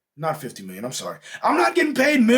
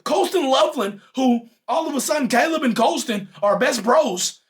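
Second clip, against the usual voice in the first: usual speaking voice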